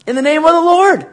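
preacher ringing out the verse; bold proclamation. Shown as a demonstration. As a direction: proclaim it like a battle cry. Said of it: "What does he say all through those verses".

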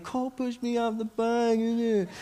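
high, slow whine of a crying little brother; mock crying. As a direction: imitate a kid wailing out a complaint. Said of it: "he's just crying".

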